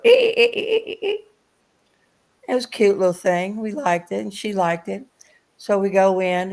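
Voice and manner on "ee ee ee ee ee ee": very high squeaky toy noise; imitating a toy's high squeak; toy bunny squeak. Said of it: "It'd go".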